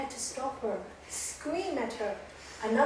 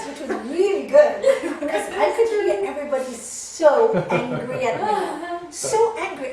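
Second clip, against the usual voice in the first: loud and laughing while talking; laughing through words; 4 semitones higher, 12 dB louder than usual. Real, not acted. Recalling the angry class, laughing loudly. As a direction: speak through laughter, slow.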